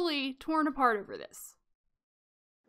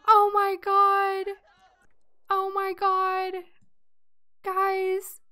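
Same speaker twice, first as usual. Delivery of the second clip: distressed wail; 5 semitones higher, 4 dB louder, much slower than usual. Real, not acted. Reacts to child being taken.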